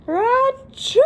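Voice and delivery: big high sneeze achoo; huge bear sneeze; loud sneeze. Acted.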